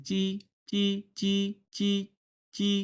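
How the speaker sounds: "চি" with the tongue pressed to the touch point; unaspirated "চি". Shown as a demonstration. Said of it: "জিহ্বাটা এইখানে দেখেন লেগে গেছে". (জিহ্বা লাগিয়ে "চি" উচ্চারণ)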